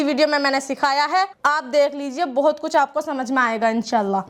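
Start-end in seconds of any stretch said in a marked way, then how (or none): none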